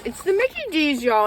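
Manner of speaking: high, thrilled announcement; excited. Real, not acted. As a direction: squeal it with glee. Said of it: "I always get so excited".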